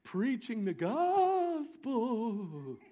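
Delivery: drawn out, very slow; slow reveal. Acted. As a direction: let each word hang. Revealing the scary word.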